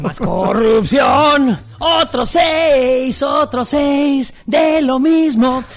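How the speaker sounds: slow, drawn-out high proclamation; mock sloganeering. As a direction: stretch every word like a rally promise.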